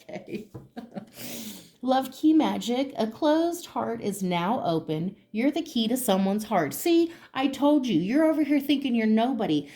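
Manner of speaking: usual speaking voice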